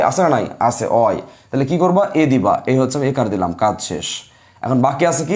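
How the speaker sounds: ordinary talk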